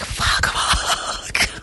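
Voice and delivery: breathless whispered curse; whispered panic. Retold or imitated. Pinned by the hag, unable to yell, whispered panic. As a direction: whisper it, frozen and scared. Can't yell out during sleep paralysis.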